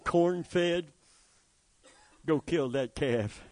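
ordinary delivery

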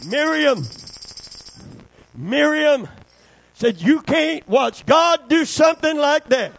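high, forceful pulpit oratory; full-force preaching. Real, not acted. Preacher telling of Miriam and Israel's victory, full-force preaching. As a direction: build the story into a shouted proclamation.

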